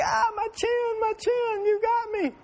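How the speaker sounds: put-on very high wailing little-girl voice crying out about her chin; imitated crying toddler. Dad imitating his daughter's cry after the helmet clip, high wail. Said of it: "continued to cry".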